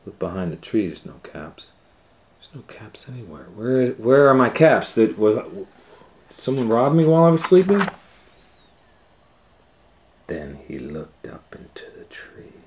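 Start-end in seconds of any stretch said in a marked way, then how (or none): 3.5-7.8 panicked. Acted. Peddler panics over missing caps. 10.3-12.5 whisper. A narrator's reading. Suspense before reveal.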